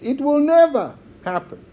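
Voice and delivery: NEVER pitched high and held before "happen"; emphatic NEVER. Shown as a demonstration. Preacher showing how emphatic the word is, NEVER stressed high. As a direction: proclaim it with full weight on NEVER. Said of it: "That's how emphatic the word is".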